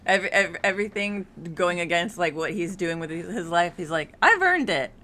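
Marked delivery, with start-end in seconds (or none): 4.2-4.9 high, loud self-justifying protest; mock defiance. Grandpa justifies how he lives his life, mock defiant outburst. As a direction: imitate someone insisting on their rights.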